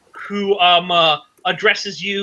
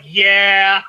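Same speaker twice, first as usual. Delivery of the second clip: laughing fairy. Acted. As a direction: scoff at the trick with a laugh. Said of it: "she's like".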